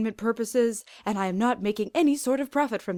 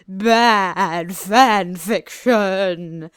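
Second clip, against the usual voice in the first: very slow, loud gloating villain; wicked villain; 7 dB louder, much slower than usual. Acted. Captor gloating at his prisoners, slow and wicked. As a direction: savour each word like a cruel joke. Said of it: "Mister X laughed wickedly".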